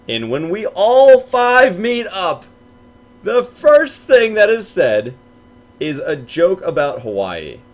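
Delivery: milk the suspense as you tell it; dramatic buildup. Real, not acted. Telling friends the reveal, slow high dramatic build.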